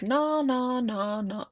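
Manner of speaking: put-on high, babyish repeated no; toddler saying no. Mom imitating her toddler refusing bed, high babyish no. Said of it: "And he'll be like".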